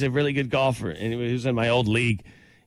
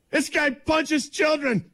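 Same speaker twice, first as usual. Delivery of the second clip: high, slow sneering heckler; mocking heckler; 14 semitones higher, much slower than usual. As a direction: drag it out mockingly.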